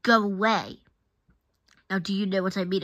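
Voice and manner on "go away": loud groaning dismissal; groaned. A narrator's reading. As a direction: send them off with a loud groan. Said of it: "he groaned".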